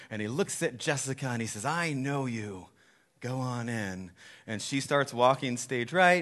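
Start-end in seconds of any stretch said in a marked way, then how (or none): 1.6-4.1 lightly voiced judge on a throne, slow and soft; quiet, measured mock pronouncement; Jesus. Jesus letting Jessica in at judgment, lightly voiced solemn Jesus. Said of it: "Jesus is sitting there on the throne".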